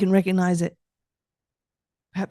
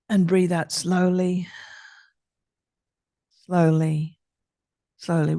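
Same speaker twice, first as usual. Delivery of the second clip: guided breathing. Acted. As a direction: talk the listener through each breath, gently. Leads a breathing exercise.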